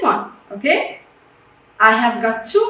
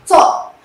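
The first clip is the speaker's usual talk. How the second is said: Tall said loud and firm as a model word; teacher modelling Tall, louder; 5 dB louder than usual. Shown as a demonstration. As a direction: sound out Tall clearly for the class. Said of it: "Just one syllable".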